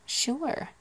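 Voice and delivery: sure with a high rising tune; accented sure. Shown as a demonstration. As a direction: say sure with the vowel stretched and lifted. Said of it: "quite a few ways of saying that".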